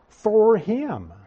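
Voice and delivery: "for him" stressed and drawn out slowly; emphatic FOR HIM. Acted. Resentful brother to father about the fattened calf, emphatic "for him". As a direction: lean heavily on "for him". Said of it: "in that sentence is very emphatic".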